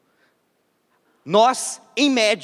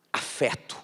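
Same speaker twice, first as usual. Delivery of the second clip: whispered; 5 semitones lower, 5 dB quieter than usual. Real, not acted. Dramatic one-word answer.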